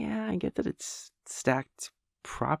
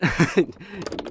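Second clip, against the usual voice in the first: a short, flustered laugh, a bit higher; nervous awkward laugh; 6 semitones higher, 6 dB louder than usual. Acted. Clerk covering an awkward overshare, nervous laugh.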